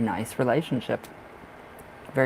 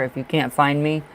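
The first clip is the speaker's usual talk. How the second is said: normal talking voice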